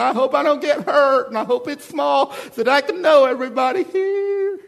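high, mocking timid voice; mock a scared churchgoer; wimpy churchgoer. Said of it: "all wimpy and safe".

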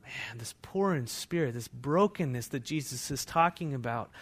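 hushed whisper. Real, not acted. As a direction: drop to a breath, let them lean in. Sudden hush after quiet pause.

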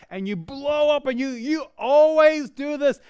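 loud, high mock outburst at the kids; angry blow-up. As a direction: snap at the kids in a hammed-up rage. Parent blowing up over spilled milk.

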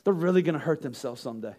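soft, quiet, caring musing; gently worried for them; calm concern. Retold or imitated. Parent stepping back to think, soft calm concern. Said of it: "stepping back going".

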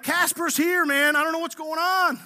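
hammed-up frightened babbling; freaking out. Preacher voicing disciples spooked by a ghost, comically freaking out. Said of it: "So they're freaking out".

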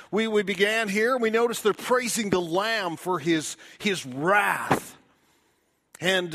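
loud, high preaching voice, pressing each point; fiery preaching. Acted. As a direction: preach it hard to the congregation. Preaching at full force.

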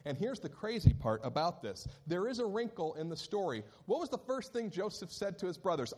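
ordinary talk

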